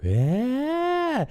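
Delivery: very high, drawn-out mock shriek; over-the-top scream, not at full volume. Shown as a demonstration. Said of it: "screaming and being like".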